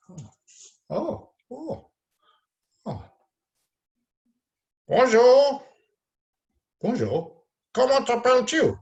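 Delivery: high, loud squeaky puppet. Acted. Teacher voicing puppet Matisse greeting the class, high and squeaky. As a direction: play a shy excited puppet friend. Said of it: "so that was Matisse".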